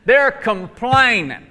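"complaining" stretched slowly and pointedly; slow, hammered stress on "complaining"; emphatic repeat. Shown as a demonstration. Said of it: "One more time".

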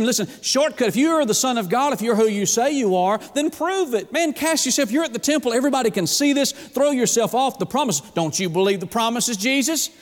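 tempting Satan. Acted. Preacher voices Satan daring Jesus to jump, insistent and taunting. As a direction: goad with urgent, high-pitched challenges. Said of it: "he tempts him".

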